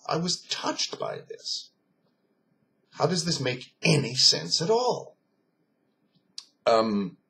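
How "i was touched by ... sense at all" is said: high, strained disbelief; moved bafflement. Retold or imitated. German man recalling it thirty years on, baffled. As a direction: voice pushed way up as if still unable to grasp it.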